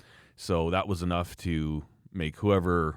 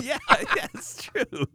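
high, drawn-out words through laughter; laughing agreement; much slower than usual. Real, not acted. Agreeing with co-host's joke about the firing, laughing. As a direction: agree while cracking up. Amused reply to listener's joke.

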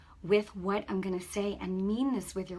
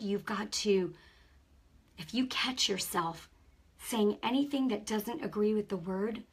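normal talking voice

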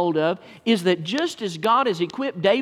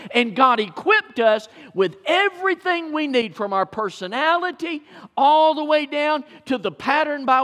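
preaching at full force; 7 semitones higher than usual. Acted. Pastor rallying Maysville Baptist Church, full-force preaching. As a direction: lift the church with each name.

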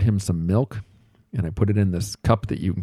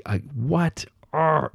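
higher-pitched mock exasperated growl; mock frustrated outburst; 7 semitones higher, much slower than usual. Dad retelling his anger at spilled milk, mock exasperated outburst. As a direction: replay the flash of irritation, half-hearted. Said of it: "I was pissed".

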